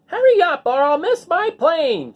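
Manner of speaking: hurried woman in a taxi, high and pushy; impatient woman. A narrator's reading. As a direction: squeal it like a frantic passenger. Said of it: "she told the taxi driver".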